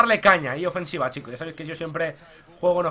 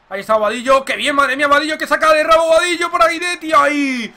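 higher, louder burst; rising play-by-play thrill; excited commentary; 7 semitones higher, 7 dB louder than usual. Real, not acted. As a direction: cheer the dribble on. Great dribble play-by-play.

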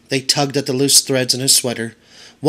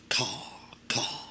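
whispered crow caw; 15 dB quieter than usual. A narrator's reading. Birds laugh at Edward.

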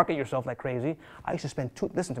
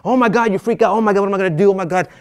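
loud, higher mock panic, hands in the air; 6 semitones higher, 13 dB louder than usual. New agent panicking over a cancelled deal, loud mock panic. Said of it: "You start freaking out".

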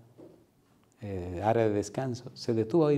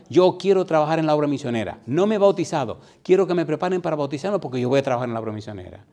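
loud, raised-pitch proclaiming; emphatic declaration; 4 semitones higher, 7 dB louder than usual. Retold or imitated. Preacher voicing would-be missionaries' written pledges, emphatic. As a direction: proclaim it with conviction.

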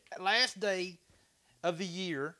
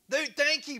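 excited thanks; 9 semitones higher, 7 dB louder, slower than usual. Real, not acted. Thanking donor after cheer.

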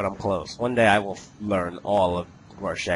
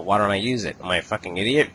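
ordinary delivery

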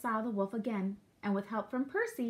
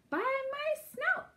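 high, drawn-out startled piglet; storybook pig's astonished yelp; alarmed cry; slower than usual. A narrator's reading. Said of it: "cried Horace".

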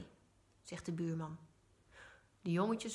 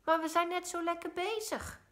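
higher, pleading call from across the garden; calling back in protest; 10 semitones higher, 3 dB louder than usual. A narrator's reading. Joep calls back to mama that they are busy, protesting. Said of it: "roept Joep terug".

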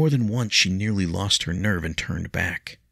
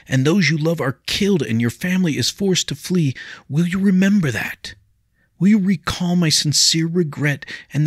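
much higher, louder entreaty; impassioned plea; 10 semitones higher, 7 dB louder than usual. A narrator's reading. William to Leah, impassioned pleading. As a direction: beg her to remember his regret.

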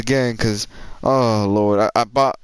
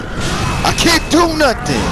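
slow, high, loud raging shout; berserk rage; 4 dB louder, much slower than usual. Real, not acted. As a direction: explode at the game in rage. Repeats it, shouting at the game.

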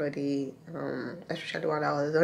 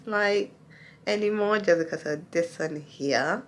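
normal talking voice

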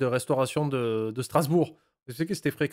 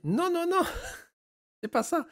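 high mock voice. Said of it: "il m'a dit".

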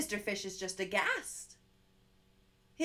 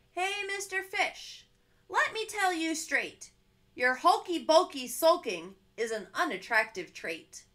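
louder, frank octopus voice; blunt octopus; 5 semitones higher, 6 dB louder than usual. Acted. Octopus bluntly telling the fish his sulking is unattractive. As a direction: tell it straight, no nonsense.

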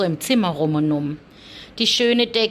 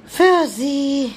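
high and louder; emphatic; 7 semitones higher, 6 dB louder than usual. Real, not acted. Owner adding the blessing for her cat, high and louder. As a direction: lift it up as a blessing.

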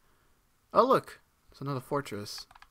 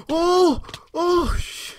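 high, loud yelps of alarm; startled yelp; 11 dB louder, much slower than usual. Real, not acted. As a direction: yelp as if jumped by an enemy. Startled by danger in game.

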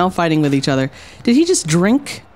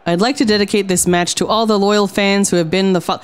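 ordinary delivery

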